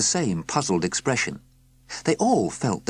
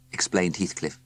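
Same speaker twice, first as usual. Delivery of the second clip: plain reading voice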